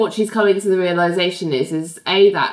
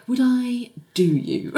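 slow, higher, teasing innuendo; suggestive; 5 semitones higher, slower than usual. Retold or imitated. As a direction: let the double meaning hang slowly. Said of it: "Ling's like".

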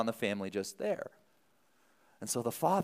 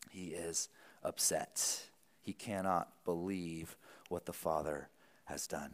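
much softer, near a whisper; hushed aside; 7 semitones lower, 11 dB quieter than usual. Acted. Preacher telling the church of the older son's anger, hushed. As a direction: lean in and hush it.

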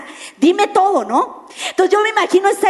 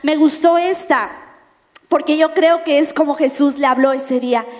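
ordinary talk

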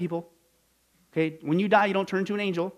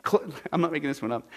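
ordinary talk, nothing marked

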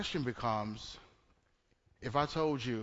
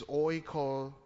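oikodamio said slowly, broken after oiko; slow, careful pronunciation. Shown as a demonstration. Preacher working through a Greek word, slow and careful oikodamio. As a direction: sound out oikodamio in two careful pieces. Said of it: "I have to say that slow".

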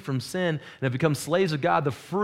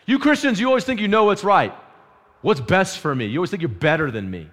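accusing critic; 6 semitones higher, 6 dB louder than usual. Retold or imitated. Voicing critics of Christians.

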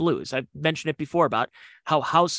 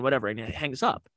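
normal talking voice

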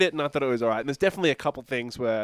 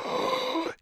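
sharp, high, breath-only gasp; mock gasp; 4 dB quieter than usual. Retelling a suspicion of a villain, mock gasp. Acts his suspicion at film.

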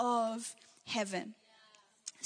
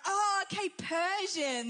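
higher-pitched dawning "oh, I see"; mock realisation; 5 semitones higher, slower than usual. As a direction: imitate someone who suddenly gets it. Imitating strangers' sudden realisation.

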